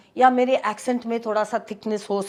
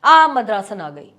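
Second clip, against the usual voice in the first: louder mocking sing-song call of मद्रासन, stretching the आ; mocking taunt; 2 semitones lower, 7 dB louder than usual. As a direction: jeer "आ, मद्रासन आ गई" like a schoolyard bully, louder. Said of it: "उसमें intonation था".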